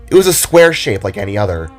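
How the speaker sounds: usual speaking voice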